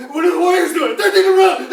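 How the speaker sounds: high, loud laughing. Real, not acted. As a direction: laugh through the headlines. Laughing reading the paper.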